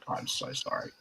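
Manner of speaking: very soft, nearly whispered sorry; hushed apology. Retold or imitated. Apologising to the granny who heard everything, hushed. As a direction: shrink the voice to a small apology.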